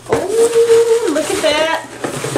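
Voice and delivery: long high squealed oh; squeal, then slow down in awe; excited. Real, not acted. Admiring the next gift, excited.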